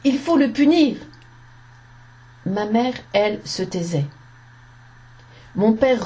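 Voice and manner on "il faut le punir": higher, indignant exclamation; narrated outcry, moderately raised voice; crying out. A narrator's reading. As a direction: call for it like an outraged onlooker. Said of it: "Ma tante et les soldats s'écrièrent".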